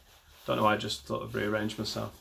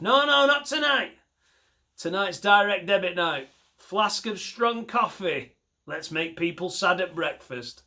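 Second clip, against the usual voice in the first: much higher, gleeful scheming voice; gleeful villain clerk; 6 semitones higher than usual. Retold or imitated. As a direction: relish ruining breakfasts. Imagined bank worker replies.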